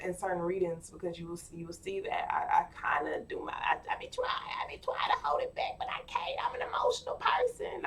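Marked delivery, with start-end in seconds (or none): 2.6-7.9 hushed breathy confession; a real tearful whisper. Real, not acted. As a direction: drop to a whisper, holding back tears. Admits holding back tears.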